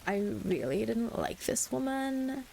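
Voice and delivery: slow, stretched Californian vocal fry, voice lifting high; Casey. Retold or imitated. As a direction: draw out each word in a creaky Cali accent. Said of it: "because she talked like this".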